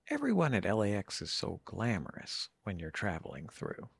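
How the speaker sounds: soft, low murmured remark; hushed imitation. Woman remarks on Los Angeles to host, hushed imitation. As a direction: deliver a backhanded compliment very softly. Quotes a woman's backhanded compliment.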